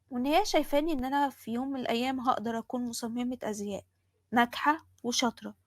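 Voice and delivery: plain reading voice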